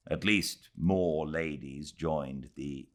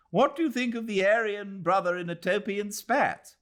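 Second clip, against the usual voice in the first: higher, put-on voice for a young English official; lightly pitched-up character voice; Ronnie's voice; 3 dB louder than usual. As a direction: voice the smug young magistrate, a touch superior. Ronnie voiced in read novel.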